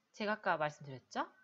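ordinary talk

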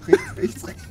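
high, loud frantic calls; frantic shouting. Real, not acted. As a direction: yell directions in a panic. Frantic game instructions.

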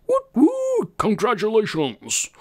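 high-pitched whooping congratulations; celebratory cheer. Pretend. Streamer congratulating a viewer on five K subs, high celebratory cheer.

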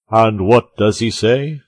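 slow, loud, self-important question; self-sufficient. A narrator's reading. Porthos questions the musketeer about the story, self-sufficient. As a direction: ask it grandly, taking your time. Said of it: "in a self-sufficient tone".

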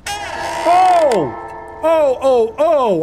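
high, loud scared cries; startled yelps. Real, not acted. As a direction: yelp in fright. Sudden scare.

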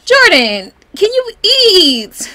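loud, drawn-out, high-pitched pleading; exasperated plea. Real, not acted. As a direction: call out, stretching the words in exasperation. Pleading with game character to eat.

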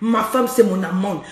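usual speaking voice

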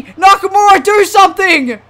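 high, loud pleading call; urgent shout. Real, not acted. Gamer begging Nakamura to do something, urgent shout. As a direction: holler desperately at the wrestler. Urging wrestler to break pin.

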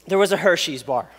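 ordinary delivery

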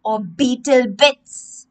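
slow, ominous drawn-out question; drawn-out hint. Shown as a demonstration. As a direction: stretch it out slowly to tease the kids. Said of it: "Are those chocolate chips".

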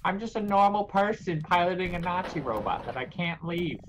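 high, hammed-up trapped victim; mock desperation. Normal person trapped piloting an enemy robot, mock desperation.

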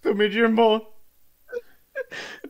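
loud, high melodramatic lament; hammed-up anguished reproach; betrayed. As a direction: wail at a supposed traitor. Doctor feels betrayed by Jimbo.

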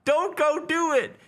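high, loud pleading to a stubborn hero; high-pitched fan begging Kratos to stay; pleading mock protest. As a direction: beg him not to go, hammed-up. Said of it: "we spent so much time going".

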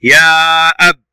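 يا stretched out, then أب cut short; long then stopped. Shown as a demonstration. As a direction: hold the aa of يا and stop on the b of أب. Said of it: "صوت طويل".

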